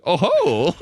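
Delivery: surprised oh ho; mock surprise. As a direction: react like the film just took a turn. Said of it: "And I'm like".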